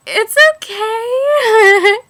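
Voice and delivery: put-on high, loud and very slow, melting over his accent; swooning reply. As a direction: stretch it out, dazzled and smitten. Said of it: "And I was like".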